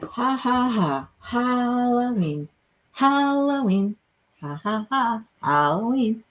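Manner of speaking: Halloween with drawn-out "ha", as in ha-ha; demonstrating "ha" syllable. Shown as a demonstration. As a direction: sound out "ha, ha", then stretch Halloween. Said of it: "that's how I say it".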